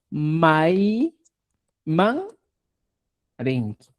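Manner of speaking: Mai, man, rinki spaced out with pauses; word by word, slow dictation. Shown as a demonstration. Teacher dictating the question to the class, each word apart. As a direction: break "Mai man rinki" into separate words. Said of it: "Esa sería la pregunta".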